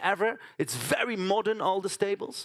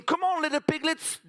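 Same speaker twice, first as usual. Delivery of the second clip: put-on child coaxing piglets; 7 semitones higher than usual. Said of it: "So, so I'm saying".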